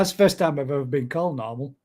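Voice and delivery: ordinary talk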